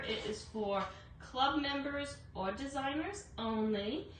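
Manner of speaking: ordinary talk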